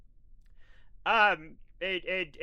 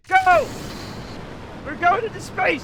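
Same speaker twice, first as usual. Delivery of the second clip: much higher, louder: yell with joy at liftoff; excited launch shout; 11 semitones higher, 8 dB louder than usual. Real, not acted. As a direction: cheer the rocket off the pad. Rocket launch in game.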